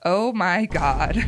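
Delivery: ordinary delivery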